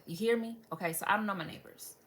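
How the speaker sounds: ordinary talk, nothing marked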